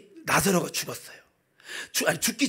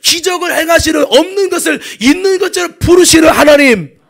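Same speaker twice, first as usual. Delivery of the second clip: loud, high proclamation of God's power; full-force preaching; 8 semitones higher, 17 dB louder than usual. Real, not acted. Preacher proclaims the God of miracles to congregation, full-force preaching. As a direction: preach it in a ringing shout.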